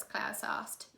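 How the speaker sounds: plain reading voice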